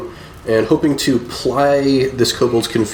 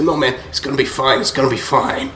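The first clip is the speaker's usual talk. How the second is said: gentle mock reassurance. Druid calmly reassuring the dying kobold. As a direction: soothe him while killing him. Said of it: "And I say".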